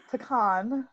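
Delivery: pecan said as puh-KAHN. Shown as a demonstration. Trying the word after cantaloupe, pecan as puh-KAHN. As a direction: stretch pecan to end on "con".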